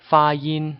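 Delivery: 发音 with both syllables high and level; 发音 said slow and flat on high first tones; two first tones. Shown as a demonstration. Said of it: "These are two first tones".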